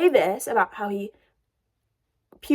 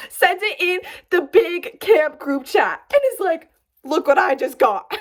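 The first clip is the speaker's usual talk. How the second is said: higher, giggling while telling; laughing through it; 4 semitones higher, 3 dB louder than usual. Real, not acted. Telling viewers about the group chat photo, laughing. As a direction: laugh as you get the words out.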